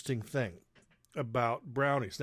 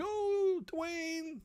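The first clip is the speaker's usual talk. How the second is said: much higher, sing-song excited mom; Phyllis gushing. Retold or imitated. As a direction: mom swooning over Christmas. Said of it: "my mom, Phyllis, was like".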